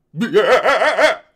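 short, high, very loud cackle. Real, not acted. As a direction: let out a sudden rattling burst of laughter. Laughs during his commentary.

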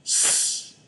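"S" as a long voiceless hiss; soft, sustained sss for "S"; isolated s sound. Shown as a demonstration. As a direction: hiss out the letter "S" alone. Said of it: "S là phát âm là".

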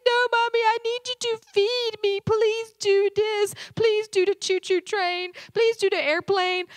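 put-on high whiny little girl voice; whiny four-year-old. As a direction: whine like a hungry preschooler. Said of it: "she's like".

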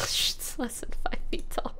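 a laughing whisper. Real, not acted. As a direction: lean in and whisper it. Secret aside about her agent.

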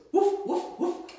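loud, high woofs in quick bursts; imitated dog bark. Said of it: "and he goes".

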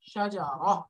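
"সাজারাহ" closing on a breathed h; pausal ha ending. Shown as a demonstration. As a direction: stop on "সাজারাহ" with final ta as h. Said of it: "আ হয়ে গেল।".

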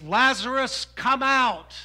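loud summoning call, up high; ringing command across the crowd. Retold or imitated. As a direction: call him out of the tomb. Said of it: "Jesus called out in a loud voice".

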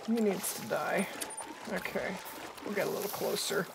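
softly, while aiming; hushed. Real, not acted. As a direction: drop to a hushed voice.

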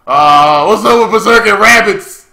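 high, loud incredulous cry; loud exasperation. Real, not acted. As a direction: yell in disbelief at the screen. Startled by rabbits on screen.

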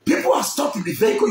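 retold angry shout. Speaker yelling at the woman over her bumper, angry shout. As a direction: yell at her to move. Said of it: "I came out and said".

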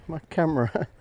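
normal talking voice

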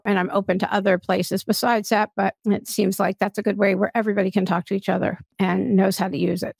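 ordinary talk, nothing marked

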